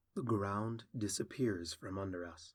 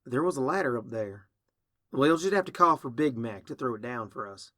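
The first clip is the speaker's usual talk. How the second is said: lightly voiced country pony, louder; Applejack, practical; 2 semitones higher, 8 dB louder than usual. Applejack planning their way out, lightly voiced and practical. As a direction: lay out the plan with a touch of country twang.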